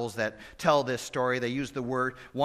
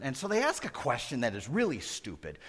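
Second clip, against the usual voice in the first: usual speaking voice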